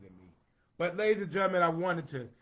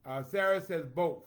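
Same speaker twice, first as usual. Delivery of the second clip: normal talking voice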